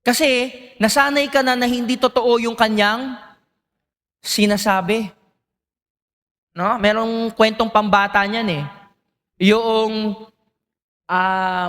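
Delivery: usual speaking voice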